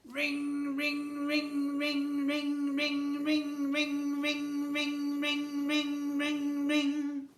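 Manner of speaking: high, ringing phone imitation. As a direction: chime out each ring like a shrill toy phone. Toy telephone that never stops ringing.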